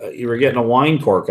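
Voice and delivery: ordinary talk, nothing marked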